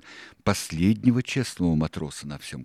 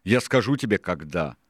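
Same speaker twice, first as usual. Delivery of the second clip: loud, raised ringleader's tirade; higher, louder pirate boss holding forth; Silver, forceful and impatient; 5 semitones higher, 7 dB louder than usual. A narrator's reading. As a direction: lay out the scheme forcefully. Silver rebukes the crew.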